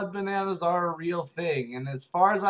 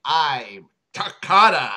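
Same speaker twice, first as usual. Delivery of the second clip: dramatic reveal; 8 dB louder, slower than usual. Acted. Dramatic reveal of true identity.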